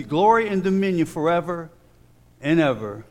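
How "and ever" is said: ordinary delivery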